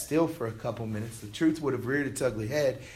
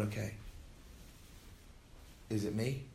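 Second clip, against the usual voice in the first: softer, hesitant inner question; timid self-doubt; 4 semitones lower, 14 dB quieter than usual. Acted. Preacher voicing the eleven disciples' doubt, softer and hesitant. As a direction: ask it to yourself, unsure.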